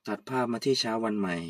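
low, soft narrator voice setting the scene; story narration. A narrator's reading.

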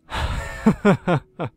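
loud, high burst of laughter; sudden loud cackle of disbelief. Real, not acted. Horror game scare, reacts.

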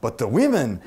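a hint of a Mexican accent that lands on the cheer; Carlos' Mexican accent. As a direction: lightly imitate a Mexican mentor's accent on the punchline. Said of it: "try to do this with Carlos' accent".

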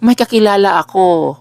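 lightly voiced eager stranger offering help; mock commenter voice. A scam commenter answering a post, lightly mocked. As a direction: toss it off like a too-helpful commenter.

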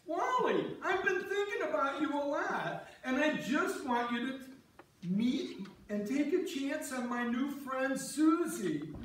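a hint of a gushing friend playing matchmaker; imitating Louise. Husband retelling how Louise set him up, mock gushing friend voice. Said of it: "and then Louise says".

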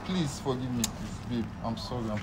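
soft, pleading apology; cringing submission. Real, not acted. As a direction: beg her forgiveness quietly. Caught cheater begging girlfriend.

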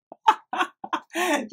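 high, real burst of laughter; giddy high laughter; delighted laugh. Real, not acted. Delighted at root beer scent.

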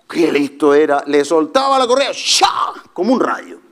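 ordinary talk, nothing marked

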